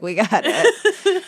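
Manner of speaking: slow, drawn-out "we got it"; a smug crew member, slowly; smug quote voice. Retold or imitated. Boys acting out the solution.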